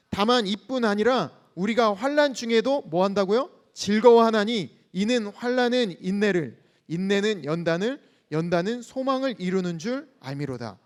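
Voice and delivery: loud, steady unison reading of the verse; scripture reading. Acted. Pastor leading the church in reading scripture, loud and steady. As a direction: read it out together like a congregation. Congregation reads verse three.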